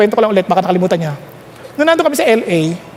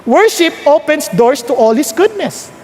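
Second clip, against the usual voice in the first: ordinary talk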